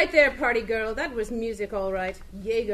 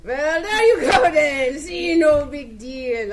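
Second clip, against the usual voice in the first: louder, slow laughing cover-up; nervous laughing; 11 dB louder, slower than usual. Acted. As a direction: laugh it off to hide the truth.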